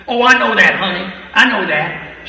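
loud, eager "I know that, honey"; excited old lady. Retold or imitated. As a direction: burst out like a fired-up church lady. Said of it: "She said".

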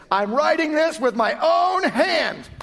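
Paul's words shouted high and slow; upset shout. Retold or imitated. Preacher voicing upset Paul's letter, loud slow shout. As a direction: bellow it out, upset.

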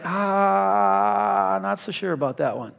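drawn-out soft "ah", then a quick mumbled doubt; hesitant and unconvinced, trailing down quietly; doubtful. Acted. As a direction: skeptical player eyeing the plan. Players doubt the coach's play.

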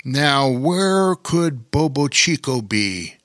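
slow, puzzled storybook king; wondering aloud. A narrator's reading. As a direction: ponder it slowly to yourself. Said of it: "Bear King wondered out loud".

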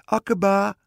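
high, loud call up into a tree; ringing storybook summons. A narrator's reading. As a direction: shout the name up to someone far above. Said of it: "ging onder aan de perenboom staan en riep".

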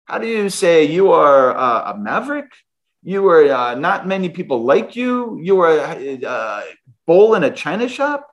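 halting imitation of a German boss, groping for English words; mock German-accented boss, searching for each phrase. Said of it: "'cause his English wasn't great".

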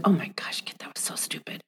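hushed whisper, scolding herself; whispered self-scolding. Retold or imitated. Host recalls scolding herself under her breath, whispered. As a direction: breathe it like a private rebuke. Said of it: "I've caught myself going".

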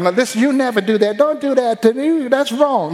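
high pleading parent voice; mock parent warning. Parent telling child not to do that, high and pleading. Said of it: "Don't have to tell him".

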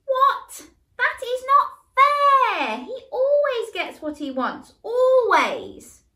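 very high, loud, slow outburst; outraged yell. Acted. Michael yells at Mum about fairness, very high and loud. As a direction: explode at the unfairness. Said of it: "Michael yelled".

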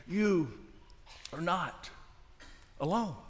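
soft and low, long pause between phrases; solemn reassurance. Real, not acted. Preacher asking the church to listen, hushed reassurance. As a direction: set each word down quietly and let it sit.

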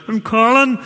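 very high, loud mock-panicked reply; hammed-up flustered answer, far too loud; acted nervous blurt. Startled visitor answers a stranger in church, mock panic.

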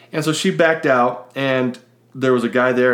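ordinary talk

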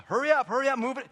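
loud, high, impatient bark at his mom; impatient shout. Retold or imitated. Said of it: "I get so impatient".

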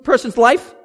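loud, high, driving sermon voice; full-force preaching. Acted. Pastor stirring the congregation: fiery preaching. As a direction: preach it out hard to the crowd.

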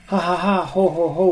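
high ho ho laugh. A narrator's reading. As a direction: laugh like you fooled him. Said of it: "laughed the two little pigs".

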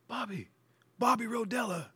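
high, faint call from far off; a name floating in from a distance; soft, high-pitched far-away calling of a name; distant call. Retold or imitated. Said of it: "in a distance".

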